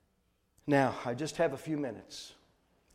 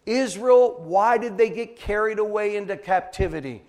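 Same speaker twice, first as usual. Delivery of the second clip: forceful, loud sermon delivery; preach; 4 semitones higher, 8 dB louder than usual. Acted. Preacher declaring God's word to the congregation, forceful preaching. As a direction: preach it out with hard, loud emphasis on key words.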